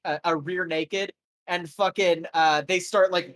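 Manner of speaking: ordinary talk, nothing marked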